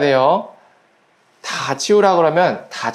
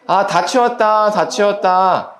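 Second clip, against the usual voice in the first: put-on drawn-out, slightly higher child's voice; child finished tidying; 4 semitones higher, slower than usual. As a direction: stretch it out like a child declaring the chore done. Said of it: "이렇게 합니다". (일을 끝낸 아이처럼 길게 늘여서)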